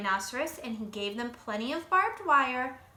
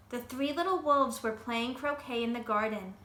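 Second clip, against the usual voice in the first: usual speaking voice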